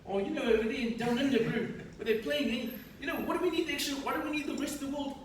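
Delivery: put-on high-pitched Kiwi accent; broad New Zealand bloke voice. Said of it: "your Kiwi accent's just getting higher and higher pitched".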